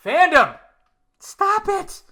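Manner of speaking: high exasperated call; exasperated scold. Real, not acted. Yelling at the fandom to stop.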